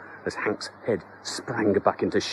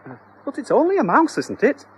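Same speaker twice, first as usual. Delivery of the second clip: much higher, doubtful question; high wife voice; 13 semitones higher than usual. Acted. Wife questions the exterminator, high and doubtful. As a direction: pitch way up and timid.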